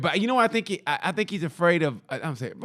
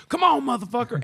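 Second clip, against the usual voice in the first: imitated challenge; 9 semitones higher than usual. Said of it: "start getting on stage. He goes".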